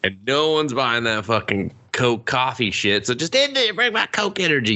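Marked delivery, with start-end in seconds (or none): 3.1-4.7 ranting. Real, not acted. Ranting at the company.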